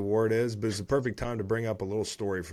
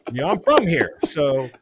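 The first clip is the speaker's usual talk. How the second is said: loud, high-pitched imitation of an athlete's interview; hammed-up player protest, pitched up and belted out; Jaire Alexander; 5 semitones higher, 8 dB louder than usual. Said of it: "But Jaire Alexander, after the game".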